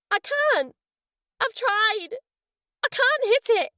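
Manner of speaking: high, half-shouted distress; woman's high voice on the verge of tears; quivering, near tears. A narrator's reading. Woman pleading down the phone, voice quivering. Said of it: "Her voice quivered as if she was ready to burst".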